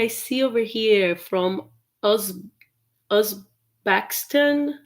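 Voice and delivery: ordinary talk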